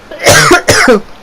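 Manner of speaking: choking cough; real coughing fit. Real, not acted. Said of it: "I'm getting choked out".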